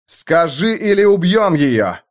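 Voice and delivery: high, forceful threat; threatening demand. A narrator's reading. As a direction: demand it with menace. Shouted threat in a fight.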